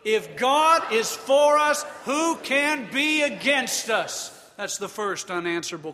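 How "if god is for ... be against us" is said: higher and louder, slow declaration. Shown as a demonstration. Preacher urging the congregation to say it louder, loud slow proclamation. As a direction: proclaim it with full voice.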